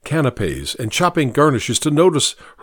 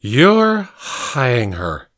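put-on drunk man; much slower than usual. Drunk accuses the cook.

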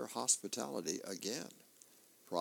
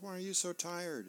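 higher, concerned grown daughter voice; daughter's voice; 7 semitones higher than usual. Acted. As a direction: pitch it up as the worried daughter. Daughter voiced in comic strip.

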